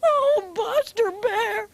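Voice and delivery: a little bunny sobbing out her story; crying bunny. Acted. Betty Bunny tells Buster Bear about her lost tooth, sobbing high.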